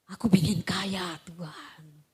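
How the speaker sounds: low breathy whisper; mock-greedy beggar whispering; whispered wish. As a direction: whisper a secret wish to God.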